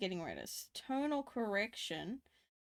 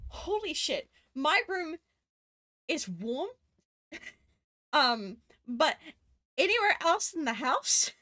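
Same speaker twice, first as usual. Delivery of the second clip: usual speaking voice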